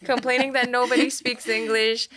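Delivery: animated, chuckling emphasis; amused listing. Real, not acted. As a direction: pile up each item with amusement. Amused listing of mother's complaints.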